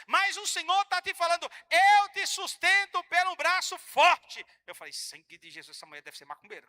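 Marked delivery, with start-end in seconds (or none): 1.7-4.4 loud, very slow, higher proclamation; God promising support; booming voice of God. Retold or imitated. Pastor voices the Lord. 4.9-6.7 much lower and softer, rising on macumbeira; a startled man's private thought; hushed alarmed aside. Retold or imitated.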